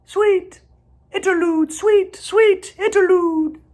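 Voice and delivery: high, lilting birdsong; high trilling bird voice, slow on the last line; song sparrow song. A narrator's reading. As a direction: sing it sweetly like a sparrow.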